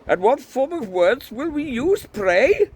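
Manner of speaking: high, loud Welsh priest challenge; Welsh accent. A narrator's reading. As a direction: demand it indignantly in a Welsh lilt. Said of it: "trying to do a Welsh accent".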